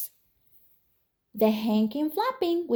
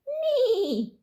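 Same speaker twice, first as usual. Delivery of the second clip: high, laughing whinny; horse neigh; 12 semitones higher than usual. Acted. Reading to kids, a giggly horse neigh.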